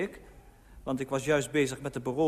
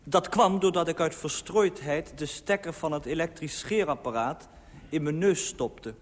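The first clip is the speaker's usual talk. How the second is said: usual speaking voice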